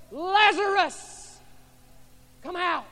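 high, loud, slow call; Jesus calling Lazarus from the tomb, loud; loud cry. Acted. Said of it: "cried in a loud voice".

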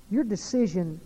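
loud pulpit challenge; preaching at full force. Acted. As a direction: confront the listener directly. Altar call challenge.

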